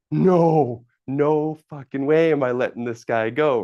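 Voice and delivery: mock outraged no way; loud refusal. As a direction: insist hard, nearly shouting. Refusing to let Don go.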